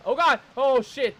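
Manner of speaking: sudden loud startled yelp; startled shout. Real, not acted. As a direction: jump at the scare and yell. Jump scare in game.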